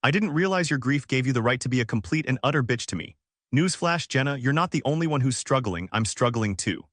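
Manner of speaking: usual speaking voice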